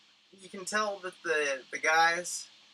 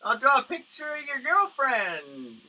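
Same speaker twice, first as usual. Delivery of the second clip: higher, louder, slow barker's pitch; caricature hawker calling out; 10 semitones higher, 9 dB louder, slower than usual. Retold or imitated. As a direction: hawk it like a pier street artist. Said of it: "and they go".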